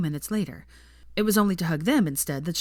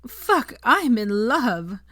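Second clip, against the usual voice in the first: high, loud, slow lovestruck sigh; swooning sigh; 6 semitones higher, 6 dB louder, much slower than usual. Acted. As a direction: melt into the words, dreamy. Veronica collapses swooning.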